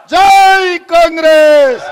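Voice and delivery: slow, loud rally slogan with జై stretched out; slogan shout. Real, not acted. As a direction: drag out జై Congress at full voice. Closing party slogan shouted. (నెమ్మదిగా, గట్టిగా జై కాంగ్రెస్ నినాదం)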